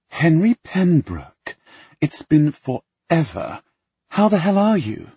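high, loud, gushing greeting; a thrilled society girl, shouting hello; gushing young woman. Acted. Penelope greets Henry loudly.

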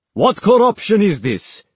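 loud, high, furious bellow; bellowing sheikh. A narrator's reading. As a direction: bellow it in her face. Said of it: "the Sheikh bellowed in Walker's face".

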